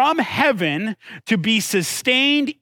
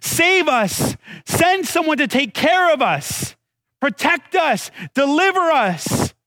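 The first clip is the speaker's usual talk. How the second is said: pleading cry for rescue, pitched high; 6 semitones higher than usual. Acted. Israelites begging God for rescue, crying out. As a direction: call out higher, stretching the last plea. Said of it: "have been crying out".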